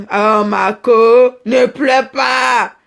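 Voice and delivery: long drawn-out, hammed-up soothing; mock consoling. Consoling a crying friend on the phone, exaggerated soothing. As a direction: stretch it out slowly, loud on the opening.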